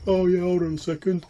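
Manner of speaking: dozy goblin reply. Acted. Goblin answering from below.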